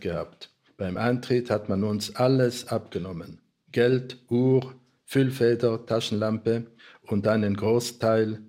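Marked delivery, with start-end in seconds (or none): none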